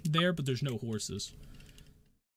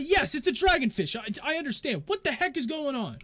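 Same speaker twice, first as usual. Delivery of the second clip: irritated; 13 semitones higher, 7 dB louder than usual. Acted. Joseph answers, annoyed.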